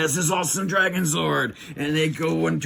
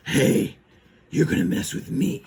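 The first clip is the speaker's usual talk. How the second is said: harsh whispered villain voice; voiceless, rasped cartoon menace; Lord Zed. Villain arriving to challenge the Rangers, rasped threat.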